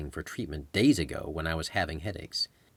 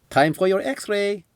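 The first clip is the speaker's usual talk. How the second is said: much higher, slow cheerful guide; Berto's voice; 14 semitones higher, 6 dB louder, slower than usual. Acted. Berto announcing the X-ray to the patient, high and slow with a smile.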